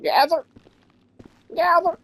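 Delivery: high mimicking of the boss; mock boss order. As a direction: parrot the boss's command back. Mimicking game boss command.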